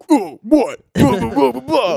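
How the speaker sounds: loud, put-on dude voice; mock dumb-guy voice; generic guy impression. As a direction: blurt nonsense as a caricature guy. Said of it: "whenever I do an impression of a guy".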